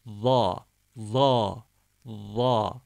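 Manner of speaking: "va" said with the tongue between the front teeth; lisped z. Shown as a demonstration. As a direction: dental lisped va. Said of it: "dilimizi arasına alarak va".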